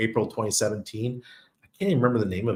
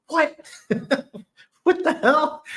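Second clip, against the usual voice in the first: high-pitched stunned outburst, breaking into laughter; astonished disbelief; 3 dB louder, slower than usual. Retold or imitated. Telling viewers about the surprise jackpot, astonished and laughing. Acting out his reaction to jackpot.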